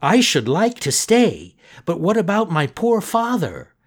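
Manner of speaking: lightly voiced boy asking after his father; Pinocchio voice. Pinocchio's voice in story.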